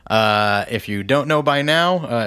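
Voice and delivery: ordinary talk, nothing marked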